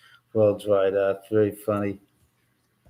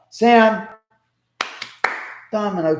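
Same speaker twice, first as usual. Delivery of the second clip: sharp, loud call, pitched up high; 9 semitones higher, 4 dB louder than usual. Real, not acted. Owner calls to the cat fighting, loud call. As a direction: call the cat off across the room.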